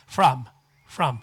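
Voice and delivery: from ending on a closed m with no vowel. Shown as a demonstration. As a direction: close the lips on the m of from. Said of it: "むと言わない".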